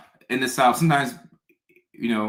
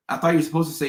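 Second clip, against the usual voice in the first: ordinary talk, nothing marked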